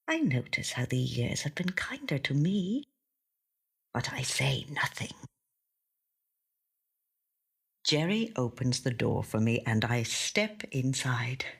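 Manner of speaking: hushed whisper, barely voiced; whispered narration. A narrator's reading. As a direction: whisper it like a private thought. Quiet reflective narration turns hushed.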